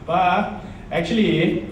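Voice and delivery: normal talking voice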